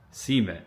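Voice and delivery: "Cement" as SEE-ment, first syllable stressed; Ohio River Valley pronunciation. Shown as a demonstration. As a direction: say "Cement" with a long, stressed "ee" up front. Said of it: "Ohio River Valley accent".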